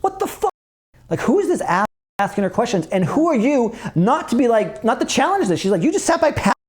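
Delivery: high-pitched indignant scolding; pissed wife. Retold or imitated. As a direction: retell her tirade, pitch jumping up. She was pissed.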